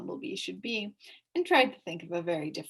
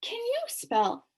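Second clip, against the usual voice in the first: admiring; 13 semitones higher, 6 dB louder than usual. A narrator's reading. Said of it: "asked Milo admiringly".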